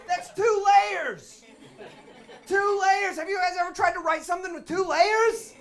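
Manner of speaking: very high, loud, laughing rant; comic raving about double meaning in plays; ecstatic. Real, not acted. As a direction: gush with manic glee. Gushing over tragic layers.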